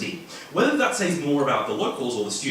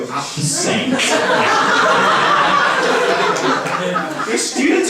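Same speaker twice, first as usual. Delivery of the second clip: high, loud, very slow laughing words; 14 semitones higher, 9 dB louder, much slower than usual. Real, not acted. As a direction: crack up mid-sentence. Breaks into laughter at joke.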